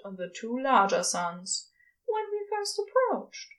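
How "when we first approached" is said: higher awed murmur; a high awestruck murmur. A narrator's reading. Aziraphale to Crowley, in a high awed murmur. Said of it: "Aziraphale murmurs".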